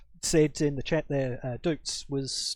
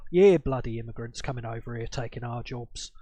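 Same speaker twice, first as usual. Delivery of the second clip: broad English accent, a bit lower; thick English accent; 4 semitones lower than usual. Shown as a demonstration. Immigrant mocking a coworker's complaint in a thick English accent. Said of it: "putting on my thickest English accent I can".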